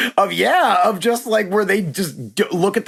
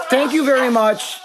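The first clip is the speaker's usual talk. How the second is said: higher, laughing thanks; laughing brush-off; 6 semitones higher than usual. Retold or imitated. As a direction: laugh him off politely, pitched up. Retold dismissal of heckler, laughing.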